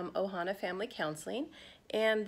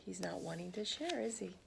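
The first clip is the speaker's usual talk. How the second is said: whispered aside; 11 dB quieter, faster than usual. Real, not acted. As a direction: lean in and whisper the question. Aside to the children.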